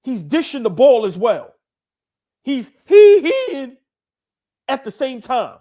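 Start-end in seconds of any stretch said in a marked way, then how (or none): 2.4-3.8 high, loud, slow squeaky hee-hee; drawn-out falsetto hee-hee squeal; imitated hee-hee. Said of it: "That he-hee was terrible".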